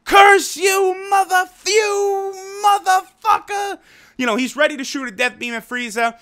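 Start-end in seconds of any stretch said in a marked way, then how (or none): 0.0-3.8 much higher, slowed, furious cartoon scream; hammed-up villain screaming at his betrayer; screaming curse. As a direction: spit the curse out like a sore loser. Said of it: "He's screaming".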